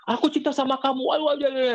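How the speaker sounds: high-pitched, hammed-up declaration of love; exaggerated lovestruck protest; mock lovesick suitor. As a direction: imitate a pleading suitor, pitched well up. Mocking a lovesick suitor's plea.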